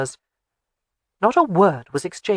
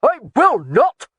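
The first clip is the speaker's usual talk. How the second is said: slow, high, loud refusal; flatly refuse, roaring; angry refusal; 7 semitones higher, 6 dB louder, slower than usual. A narrator's reading. Laird refuses Jamie's order.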